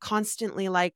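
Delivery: usual speaking voice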